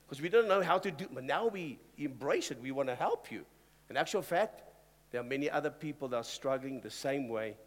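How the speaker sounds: ordinary talk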